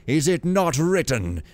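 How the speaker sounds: booming god's rebuke, mildly done; voice like thunder. Acted. Builder rebuking the rich novice, thundering voice. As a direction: declaim like a thundering lord. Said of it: "in a voice like thunder".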